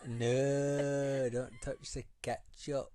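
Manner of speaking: slow, hammed-up Hull impression; Hull accent. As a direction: imitate Hull with a long fronted 'No'. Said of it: "I was just fascinated by her accent".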